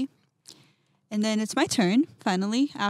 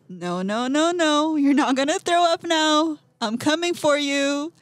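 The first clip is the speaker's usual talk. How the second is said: higher and louder, a taunting inner voice; annoying taunting voice; 6 semitones higher, 6 dB louder than usual. Retold or imitated. Voice in her head taunting her, higher, louder, mocking. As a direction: tease her like a pest that won't leave. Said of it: "the annoying voice again".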